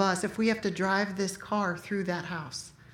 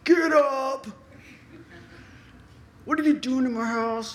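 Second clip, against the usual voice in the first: angry yell; 3 semitones higher than usual. Retold or imitated. Father finds boy on couch.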